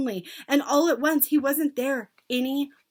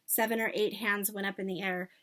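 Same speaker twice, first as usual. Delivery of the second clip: ordinary delivery, nothing marked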